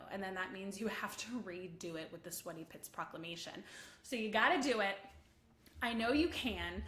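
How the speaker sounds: usual speaking voice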